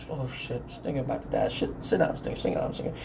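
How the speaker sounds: urgent hushed whisper; retold panicky whispered aside; alarmed whispering. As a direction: warn a friend under your breath. Onlookers urgently hush their friend.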